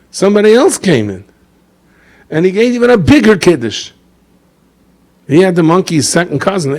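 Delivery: ordinary talk, nothing marked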